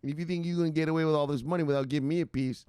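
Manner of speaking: high, punchy mock-outlaw challenge; imitated tough woman. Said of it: "She said".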